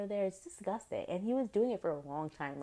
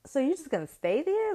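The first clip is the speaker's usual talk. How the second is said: higher, louder disbelieving question; incredulous challenge; 9 semitones higher, 8 dB louder than usual. Retold or imitated. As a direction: push her, can't believe it. Speaker challenging the girl.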